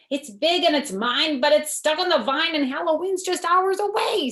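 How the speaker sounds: the witch insisting it's hers; a hammed-up storybook witch. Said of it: "said the witch".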